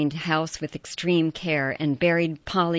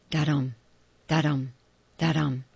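heartbeat da-dum; 3 semitones lower than usual. A narrator's reading. Heartbeat sound effect chant.

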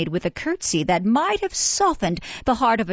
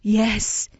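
ordinary delivery, nothing marked

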